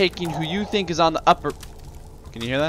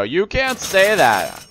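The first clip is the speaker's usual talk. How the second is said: slow, louder indignant scold; mock scolding; 6 semitones higher, 4 dB louder, much slower than usual. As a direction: parody an offended critic. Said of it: "and then go".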